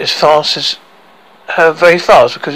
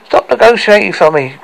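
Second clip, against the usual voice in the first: higher, mildly indignant cry; 6 semitones higher, 4 dB louder than usual. Acted. Girl interrupting the men's bargaining, crying out. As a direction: break in with a raised voice.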